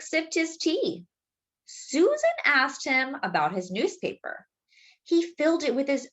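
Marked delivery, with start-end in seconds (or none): none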